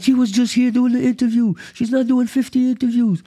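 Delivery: high, squeaky mock-tantrum voice; manager flipping out. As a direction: imitate a little manager freaking out in a high pitch. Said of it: "this little dude who's a manager is flipping out".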